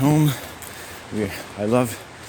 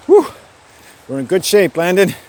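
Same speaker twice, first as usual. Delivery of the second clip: higher, loud self-encouragement; upbeat cheer; 8 semitones higher, 10 dB louder than usual. Real, not acted. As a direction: call it out loud and high, pleased with yourself. Cheering himself after hike.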